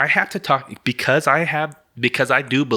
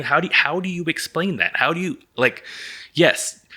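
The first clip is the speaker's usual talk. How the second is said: ordinary delivery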